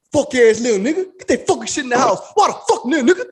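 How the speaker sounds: hammed-up yelling at the lazy kid; ranting old man.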